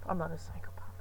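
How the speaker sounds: quick whispered denial; soft whispered joke; whispered aside. Real, not acted. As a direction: hush it as a sneaky aside. Joking aside, dropped to whisper.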